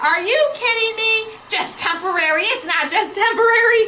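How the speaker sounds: high-pitched imitated indignation; hammed-up exasperated mom; mock outrage. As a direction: protest in disbelief, playing the fed-up mom. Acting out mom's outraged reaction.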